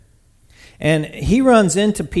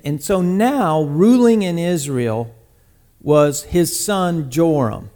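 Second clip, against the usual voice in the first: ordinary talk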